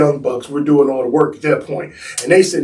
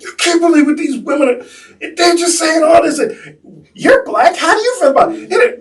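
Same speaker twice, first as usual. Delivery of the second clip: livid rant; 12 semitones higher than usual. Retold or imitated. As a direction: pour out the outrage, pitch climbing.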